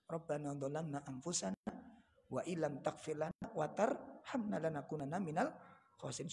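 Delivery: soft, low chanted Arabic prayer; prayer recitation. Acted. Preacher reciting Yunus's prayer to congregation, soft and low. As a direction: recite it softly, like a whispered supplication.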